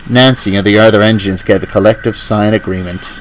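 usual speaking voice

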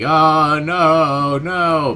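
loud, high hammed-up oh no; fake panic. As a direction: pretend to freak out. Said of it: "pretend to freak out like".

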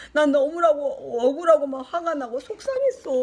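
usual speaking voice

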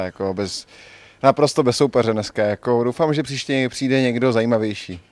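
ordinary talk

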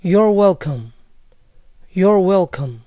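American you're welcome, falling. Shown as a demonstration. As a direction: answer like an American. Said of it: "De nada".

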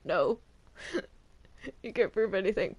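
normal talking voice

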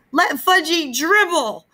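high, slow, strained scream. A narrator's reading. Peter screaming at his mother, high and slow. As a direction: scream it at mom in despair. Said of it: "I screamed".

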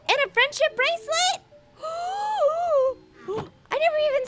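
high, thrilled squeal into a gasp; delighted surprise. Real, not acted. Player finds gift from Lizzie, delighted surprise.

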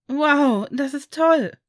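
high, loud cry of delight; excited exclamation. A narrator's reading. Harry reacts to Daniel's adoption, calling out excitedly. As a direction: call it out like a thrilled boy. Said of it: "rief Harry".

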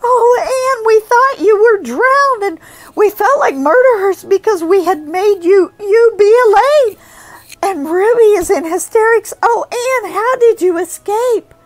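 high, loud tearful rush; weeping with relief. A narrator's reading. Diana weeping on her rescued friend's neck. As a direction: sob it out in relief. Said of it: "weeping with relief and delight".

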